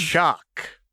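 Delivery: put-on high, sing-song playful girl; high chirpy menace; manic Jinx voice. A narrator's reading. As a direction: tease the hostage brightly. Jinx cuts in.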